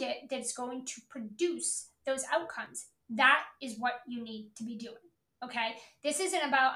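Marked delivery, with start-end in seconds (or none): none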